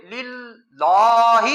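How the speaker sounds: للہ split into separate pieces, very slowly; teacher showing the wrong broken للہ, very slow; broken lillah. Shown as a demonstration. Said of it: "للہ کو توڑ کر پڑھنا بھی ٹھیک نہیں".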